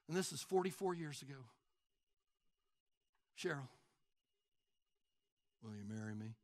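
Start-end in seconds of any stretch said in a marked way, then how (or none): 3.4-6.3 low, quiet, deadpan proposal; no intensity. Acted. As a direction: propose as if you barely care. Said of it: "the intensity of your request makes a difference".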